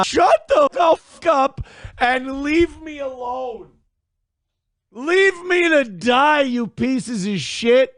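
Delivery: hammed-up meltdown at the chat; mock tantrum. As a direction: yell it like a dramatic fake breakdown.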